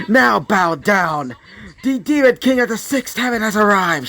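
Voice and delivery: high-pitched, grandiose announcement; demon king proclamation. Acted. Nobunaga demanding all bow at her entrance, grandiose proclamation. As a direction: draw out the command, then declare it regally.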